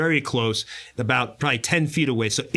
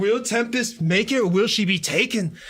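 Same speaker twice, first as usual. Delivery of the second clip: dramatic announcer; 2 semitones higher than usual. Acted. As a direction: build suspense like a trailer. Dramatic game-master cliffhanger.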